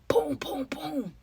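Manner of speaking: breathy whispered boom boom boom; hushed imitation of the door booming. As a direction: whisper the kicks like distant thuds. Imitating the door being kicked.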